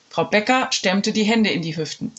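usual speaking voice

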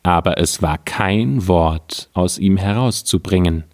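ordinary delivery

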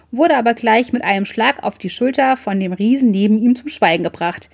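usual speaking voice

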